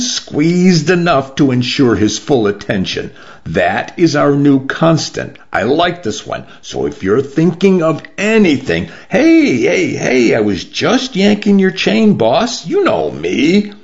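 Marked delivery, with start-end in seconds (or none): 3.5-8.9 a slightly higher, emphatic warning; firm boss laying down a threat, lightly played; lightly voiced stern boss. Rona's warning to Hamish. 9.1-13.7 high-pitched, jokey backing off; old rogue talking his way out of trouble; placating old joker. Acted. Hamish backs off, joking.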